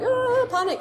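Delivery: high-pitched mock panic; panicking puppy. Trainer voicing puppies stuck at a small dike, playful mock panic. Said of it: "they were a bit like".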